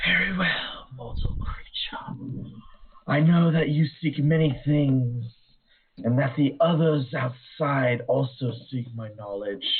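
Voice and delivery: eerie whispered banshee, swelling high. Acted. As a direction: breathe it out like a ghostly spirit. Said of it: "how to do a Banshee's voice".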